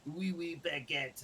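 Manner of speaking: mocking Americans doing French; clichéd tourist French, teasing. Mocking how Americans talk to French people, mock French. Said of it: "a lot of people go".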